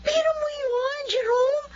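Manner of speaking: high, tearful excitement; eager wife with a catch in her voice; excited pleading. Acted. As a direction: gush with hope, almost crying. Wife thrilled by abroad offer.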